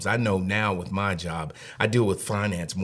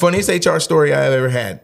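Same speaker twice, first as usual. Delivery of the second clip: higher and louder teaser; mock hype; 7 semitones higher, 9 dB louder than usual. Announcing his funniest HR story, hammed-up excitement. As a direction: sell it like a headline.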